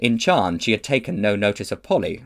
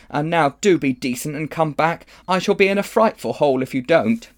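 higher, louder earnest appeal; pleading boy; 6 semitones higher, 4 dB louder than usual. A narrator's reading. Diggory implores Polly to come back, pleading. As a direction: beg her to be decent.

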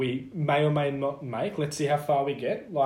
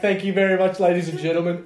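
mock stage speech; 3 semitones higher, 7 dB louder than usual. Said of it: "And then we're like".

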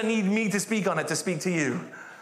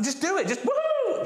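cheery woohoo; 12 semitones higher than usual. Retold or imitated. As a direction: coax with a big whoop. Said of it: "I was like".

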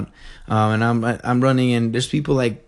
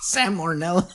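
word said through a laugh; 12 semitones higher, 6 dB quieter than usual. Real, not acted. Laughing while repeating word.

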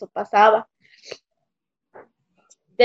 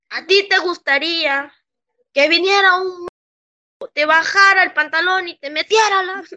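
higher-pitched, mildly outraged questioning; retold indignant challenge; 7 semitones higher than usual. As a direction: act out a boss confronting someone, pitched up. Retells boss's indignant confrontation. (indignación imitada, con voz más aguda)

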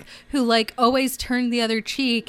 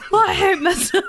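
laughing through quote; 7 semitones higher, 3 dB louder, faster than usual. Retold or imitated. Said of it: "he's like".

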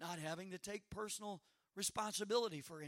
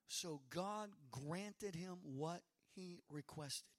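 scripture reading; 2 semitones lower than usual. Acted. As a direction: read the verses with weight. Reads scripture slowly.

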